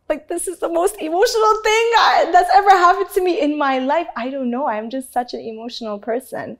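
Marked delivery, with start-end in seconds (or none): 0.1-4.1 high, loud imitation of being overcome; mock tearful outburst. Judge recalling crying at a performer's story, mock tearful outburst. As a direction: play up your own weepy gushing. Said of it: "And I start crying".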